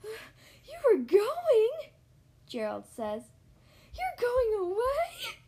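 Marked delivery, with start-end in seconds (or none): 0.0-1.9 high, loud, drawn-out question; stunned, stretched-out cry of alarm; shocked disbelief. Acted. Gerald shocked by Piggy's news. 4.0-5.4 high, loud, slow wailing question; hammed-up dismay, stretched out; distraught disbelief. Gerald shocked by Piggy's news.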